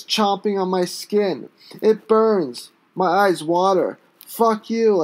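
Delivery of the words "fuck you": breathless, deflated last insult; winded spit. Acted. Beaten and winded, he spits a final insult. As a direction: spit it out with no fight left. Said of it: "I spit one last time, feeling winded".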